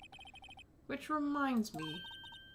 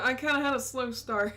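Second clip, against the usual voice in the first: ordinary talk, nothing marked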